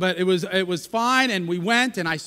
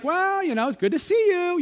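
high, polite brush-off with the tune lifting at the end; "see" lifted up high in a sing-song, polite goodbye; lilt going up; 8 semitones higher than usual. Retold or imitated. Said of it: "a little lilt going up".

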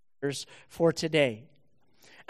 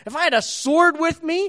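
much higher, louder threat; furious Balaam; 10 semitones higher, 6 dB louder than usual. Acted. Balaam threatening his donkey, higher and louder. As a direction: threaten it at full pitch. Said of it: "Balaam's very concerned about how he looks".

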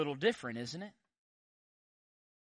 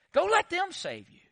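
high-pitched sarcastic dismissal; God; 12 semitones higher than usual. Acted. God fed up with repeat offenders, sarcastic. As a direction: toss it off as a mock dare. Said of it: "He's being sarcastic".

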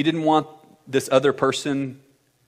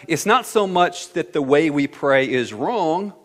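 ordinary delivery

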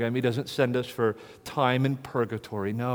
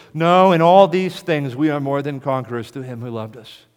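full-force preaching; 3 semitones higher, 8 dB louder than usual. Acted. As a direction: thunder it from the pulpit, slowing on key lines. Preaching Romans loudly.

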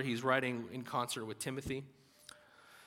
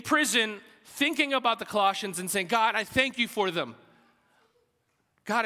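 raised, louder preacher's voice; impassioned preaching; 6 semitones higher, 6 dB louder than usual. Acted. Preacher picturing Paul thanking God from prison, impassioned. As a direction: preach it with force to the room.

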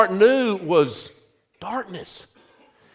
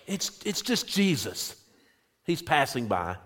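softly voiced bystander, trailing lower; informant in the crowd; 5 semitones lower, 11 dB quieter than usual. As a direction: pass on the news quietly, offhand. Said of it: "someone informs him".

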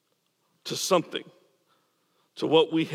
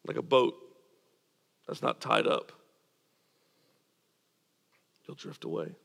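much softer, almost whispered; fading to a whisper; 10 semitones lower, 5 dB quieter than usual. Acted. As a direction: let the words drift away into a hush. Boat drifting image, voice fades.

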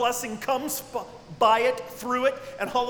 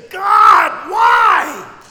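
much higher, much louder cry to heaven; anguished shout; 12 semitones higher, 17 dB louder than usual. Retold or imitated. Believer on knees screaming why at God. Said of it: "when you are yelling, screaming, crying".

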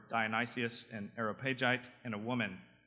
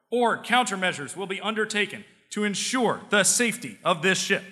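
higher, louder pompous threat; blustering captain; 8 semitones higher, 8 dB louder than usual. Acted. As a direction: boom it out like a proud admiral. Carrier captain threatening in joke.

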